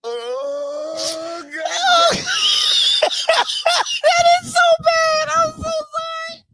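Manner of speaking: high squealing laugh-talk; wheezing with laughter, can barely speak; helpless laughter. Real, not acted. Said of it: "I'm sorry. I don't know why this is so funny".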